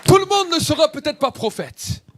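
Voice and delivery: soaring sermon peak; preaching exclamation. Real, not acted. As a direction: lift the congregation with a high call. Preacher rising to a climax.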